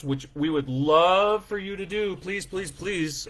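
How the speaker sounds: eager, half-joking entreaty; playful pleading. Real, not acted. Begging listeners to post about them.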